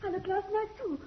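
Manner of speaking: high, soft frightened pleading; frightened and clinging to him in relief; wife shaken and relieved after running through the forest; breathless fear. Acted. Wife frightened, relieved to find husband.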